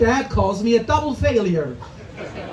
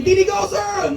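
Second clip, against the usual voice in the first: higher, bright shouted "Didi go, sir!"; motorbike driver call; 8 semitones higher than usual. Retold or imitated. Motorbike driver yelling from the sky at a pedestrian, pitched up. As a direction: call out like a Saigon moto driver. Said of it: "a motorbike will drop out of the sky going".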